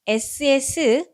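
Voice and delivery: 스 stretched out slowly; slow letter sound. Shown as a demonstration. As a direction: hiss the 스 long. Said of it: "합쳐지면서 순". (스를 길게)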